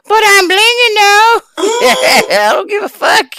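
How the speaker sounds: put-on high, loud sassy girl voice. Mocking [name] in a high, loud sassy voice. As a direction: squeal it like a brash party girl.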